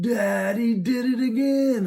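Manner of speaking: high, loud, slow tattling whine; tattling little girl. Retold or imitated.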